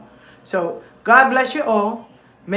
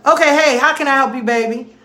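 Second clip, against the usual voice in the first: loud mock questioning; 5 semitones higher, 5 dB louder than usual. Acted consultation with a caller.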